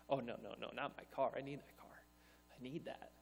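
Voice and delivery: low, very soft protest; hushed, clinging refusal; reluctant pleading. Acted. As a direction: plead under your voice to keep it. Acted refusal, bargaining with God.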